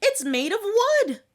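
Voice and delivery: high, loud warning shout. Acted. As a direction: yell it down the hole at the mice. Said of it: "he shouted".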